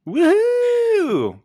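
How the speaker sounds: high, loud whoop; excited cheer. Real, not acted. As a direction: cheer her in. Cheering for Maggie.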